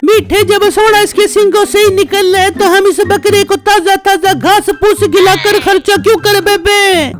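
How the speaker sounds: wife's voice. Acted. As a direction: play the scheming wife cooing at her husband. Wife plots to save money. (पत्नी की ऊँची, मीठी आवाज़)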